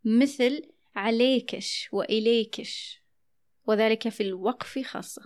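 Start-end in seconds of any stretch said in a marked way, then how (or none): 0.9-3.0 slow, عليكش وإليكش with sh added after k; kashkasha dialect. Shown as a demonstration. Host shows the added sound at a pause, kashkasha dialect. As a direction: tack sh onto the k in عليكش وإليكش. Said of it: "يزيدون حرف الشين بعد الكاف".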